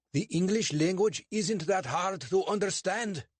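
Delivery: a suspicious bobby lecturing two suspects; portly constable, stern and clipped; English constable. Acted. Constable character voice.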